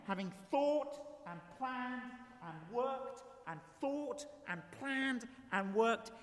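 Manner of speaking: slow and soft, savouring each word; drawn out. Acted. Preacher dwelling on the rich man's long planning, slow and soft. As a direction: stretch every word as if the work never ends.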